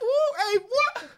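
very high, loud hyped shriek; excited whoop. Pretend. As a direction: shriek with delight. Acting out hyping up partner.

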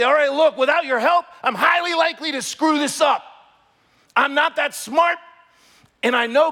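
loud, high, pleading prayer to God; fervent shouted prayer. Pretend. A believer praying at dawn, shouting for wisdom. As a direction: cry out to God with full force. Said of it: "you gotta say".

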